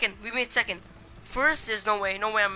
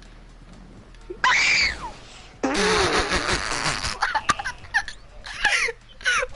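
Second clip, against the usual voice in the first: loud laughing shout; 5 dB louder than usual. Real, not acted. Own stunt backfired.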